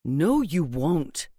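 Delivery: slow, firm warning; pointed tone. A narrator's reading. Mother-in-law warning daughter-in-law, slow and pointed. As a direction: draw it out as a warning. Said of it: "a tone in her voice that had Raj paying intense attention".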